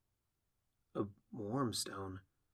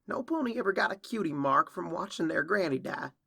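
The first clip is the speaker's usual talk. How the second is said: high, louder filly's protest; bitter retort; 8 semitones higher, 5 dB louder than usual. Acted. Filly walking away from the quarrel, bitter retort. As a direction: argue back hotly, pitched up.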